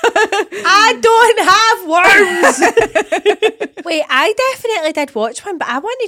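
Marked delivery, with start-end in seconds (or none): 0.0-3.9 slow, loud laughing shout; laughing protest. Real, not acted. Denying she has worms, slow loud laughing shout. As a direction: shout the denial while laughing.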